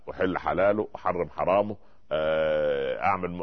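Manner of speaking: usual speaking voice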